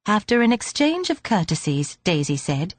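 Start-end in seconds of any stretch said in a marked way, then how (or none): none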